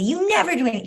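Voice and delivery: loud, high, resentful outburst; angry accusation. Retold or imitated. Daughter snapping at her parent, loud and resentful. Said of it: "And she'll say".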